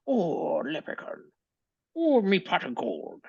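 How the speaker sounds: squeaky Irish leprechaun; high Irish brogue, gold-hungry. Pretend. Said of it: "That's just the accurate Irishman".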